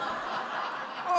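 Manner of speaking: short airless laugh; breathy laugh. Real, not acted. Storyteller cracks up at the memory, breathy laugh.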